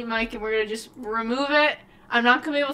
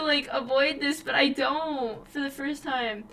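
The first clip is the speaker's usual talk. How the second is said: usual speaking voice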